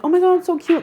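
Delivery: high, loud gush over something cute; squeal-like retold delight. Reacting to fiance's cat photo, gushing.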